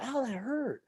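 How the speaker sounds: put-on high whiny little-kid ow; high pained whine. Boy hit by a ball at school, high whiny ow. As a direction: whimper it like a hurt seven-year-old. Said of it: "I'm like".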